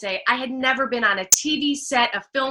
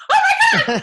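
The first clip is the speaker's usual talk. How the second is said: very high, squealed mock excitement; thrilled squeak, hammed up; overjoyed reaction; 7 dB louder, much faster than usual. Actress retelling her reaction to the casting director, overjoyed.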